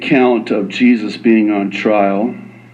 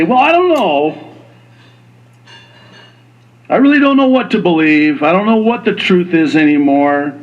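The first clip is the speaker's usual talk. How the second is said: hand-wringing doubter; 5 semitones higher than usual. Acted. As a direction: voice a bewildered skeptic, pitched up then easing down. Voicing an uncertain doubter.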